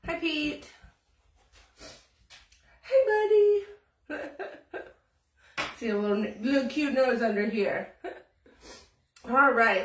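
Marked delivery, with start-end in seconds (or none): none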